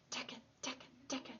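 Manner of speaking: fast, breathy whispered taka taka; soft knocking sound. Acted. Narrator mimics bamboo stalks, whispered.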